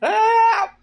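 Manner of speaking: high yelled cry of help; retold scream for help. Derek panicking outside, screaming for help. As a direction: screech for help like Derek did. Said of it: "he's just screaming".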